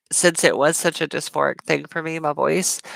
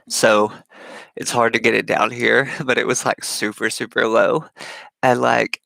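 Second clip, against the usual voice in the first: put-on old low voice; 6 semitones lower than usual. Shown as a demonstration. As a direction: drop the voice very low, far below your own. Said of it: "Imma do this for you guys".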